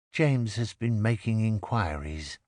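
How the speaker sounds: ordinary delivery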